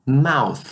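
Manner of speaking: "Mouth" ending in a voiceless TH; English TH. Shown as a demonstration. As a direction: end "Mouth" with tongue between the teeth. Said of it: "este som que representamos assim".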